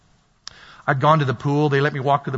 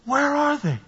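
frantic searcher; 9 semitones higher, 4 dB quieter than usual. Acted. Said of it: "And you're going".

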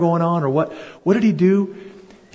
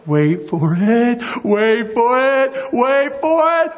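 slow, each repeat climbing higher; slow building wait for it; 7 semitones higher, slower than usual. Shown as a demonstration. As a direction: draw it out, building to the reveal. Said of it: "You do a".

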